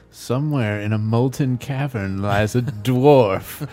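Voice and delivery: slow, low mock-mysterious storyteller; hammed-up portentous quest voice; cryptic hint-giver. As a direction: intone it like a riddle, drawn out and low. Acting cryptic game master hint.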